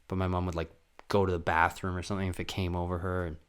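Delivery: normal talking voice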